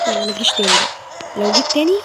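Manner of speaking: loud, rising warning to the monster; defiant yell at the creature; loud threat. Real, not acted. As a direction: shout a threat at it.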